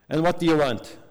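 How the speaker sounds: mildly irritated storyteller's pharmacist, voice lifted; annoyed. A narrator's reading. Busy pharmacist to a waiting little girl, annoyed. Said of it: "asked in an annoyed, uh, tone of voice".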